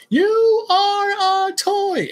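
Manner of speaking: high, slow, stretched-out yell; yelling Woody. Retold or imitated. Woody yelling at Buzz under the van, high and slow. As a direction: hurl it at him like a furious cowboy toy.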